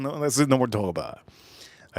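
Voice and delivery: ordinary delivery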